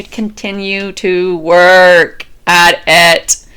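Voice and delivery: slow, low and weighty; slow deliberate final repetition; drawn-out emphatic mantra. Shown as a demonstration. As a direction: stretch every word out like a vow. Closing the repeated mantra.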